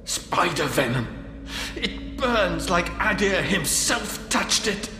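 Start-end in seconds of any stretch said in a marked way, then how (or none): 1.7-4.9 high, slow cry of pain; pained, drawn-out wail of words; agonized. Acted. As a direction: suffer through each word as venom burns. Describes burning spider venom in wound.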